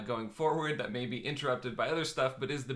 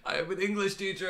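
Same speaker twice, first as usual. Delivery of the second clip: mock teacher; 8 semitones higher, 4 dB louder than usual.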